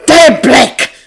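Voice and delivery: loud, high, vicious yell; vicious scream. A narrator's reading. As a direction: spit it out as a vicious shout. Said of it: "viciously screams".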